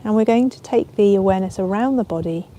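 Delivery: ordinary delivery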